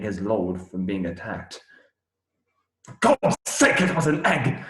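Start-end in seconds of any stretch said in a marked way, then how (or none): 2.8-4.6 high, loud indignant cry; outraged outburst. Acted. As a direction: burst out at the injustice. Outrage at unjust killing.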